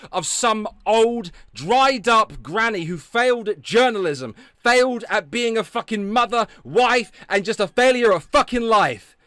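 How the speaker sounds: big, loud, over-the-top showman welcome that turns into a tirade; loud mocking rant. Streamer greeting his audience, then loudly mocking a critic. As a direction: belt it out like a gleeful ringmaster mocking his critics.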